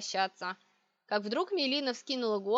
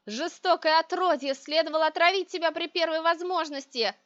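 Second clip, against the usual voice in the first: louder, higher witch's tirade; enraged roar; 6 semitones higher, 5 dB louder than usual. A narrator's reading. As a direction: hurl it as a furious curse. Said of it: "вдруг взревела ведьма".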